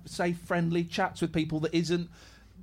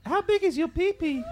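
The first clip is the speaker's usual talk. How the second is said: man doing a woman's voice; 7 semitones higher than usual. Pretend. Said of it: "Can anyone do a really good girl's voice?".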